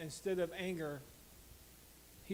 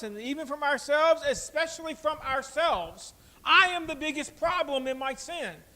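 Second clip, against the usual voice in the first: loud, much higher, driving sermon voice; full-force preaching; 7 semitones higher, 10 dB louder than usual. Acted. Pastor confessing to the congregation, full-force preaching.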